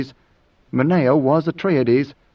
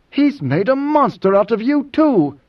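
high-pitched, horrified outburst; appalled accusation; 9 semitones higher than usual. Acted. Idaho accuses Moneo of being made monstrous, appalled. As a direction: hurl it at him, appalled.

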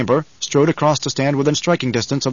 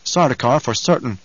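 plain reading voice

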